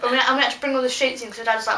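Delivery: ordinary delivery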